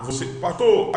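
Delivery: normal talking voice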